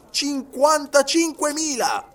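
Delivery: high, slow exclamation of the figure; emphatic exclamation. A narrator's reading. Detective correcting the servant's sum, exclaiming emphatically. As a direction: draw the number out, pitched high. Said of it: "esclamò Fix".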